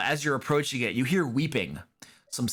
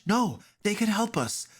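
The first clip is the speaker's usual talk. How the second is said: put-on pleading little girl; 9 semitones higher than usual. Girl begs the party.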